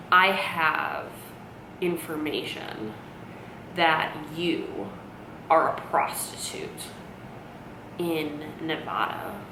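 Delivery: slow, low, hushed revelation in fragments; long pauses between phrases, sinking toward a whisper; riddling mom. Retold or imitated. Mom gravely confronting her daughter, hushed and cryptic. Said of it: "talking almost in, like, a riddle".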